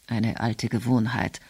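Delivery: low, soft murmur; quietly murmuring an old habit. A narrator's reading. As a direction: mutter it half to yourself. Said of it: "murmelte Emil".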